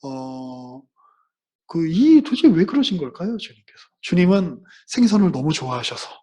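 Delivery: ordinary delivery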